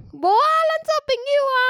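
mock old uncle insisting; insistent old uncle. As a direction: press the friendship like a stubborn grandpa. Retold uncle insisting on friendship.

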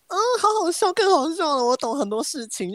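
high, gleeful laughing voice; delighted realization. Retold or imitated. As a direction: suddenly get the joke and delight in it. Acting out someone getting the joke.

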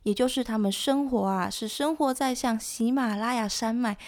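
usual speaking voice

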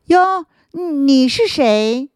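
high, slow startled call; surprised, drawn-out question in the dark; startled little root. Acted.

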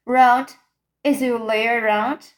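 loud, mildly thunderous call; thunderous shout. A narrator's reading. Giant calls into the cave from outside, moderately thunderous shout. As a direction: call out as a booming giant, somewhat restrained. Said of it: "a voice like thunder shouted".

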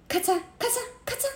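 high, quick imitated snipping; rapid scissor snips. A narrator's reading. Narrator voices panicked barber hacking at his hair, rapid snips. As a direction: chop out fast snip noises.

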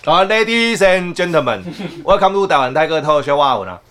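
slow, loud announcer welcome; show host intro. Acted. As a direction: open the show like an emcee.

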